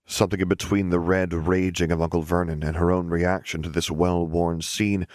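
ordinary delivery

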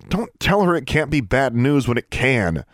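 high, restrained mock shout; exasperated shout. A narrator's reading. Girl inwardly protesting at her friends' reassurance, exasperated shout. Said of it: "wanted to shout at them".

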